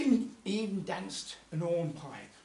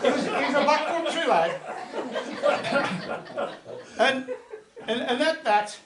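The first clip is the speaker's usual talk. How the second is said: laughing; 7 semitones higher, 6 dB louder than usual. Real, not acted. Laughs at own story.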